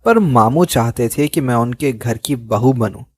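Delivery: plain reading voice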